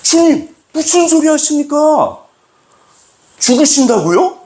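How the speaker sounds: high, loud scolding protest; rebuking. Retold or imitated. Peter rebuking Jesus in a high, loud protest. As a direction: scold your master in disbelief. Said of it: "꾸짖어요".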